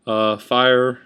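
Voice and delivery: ordinary talk, nothing marked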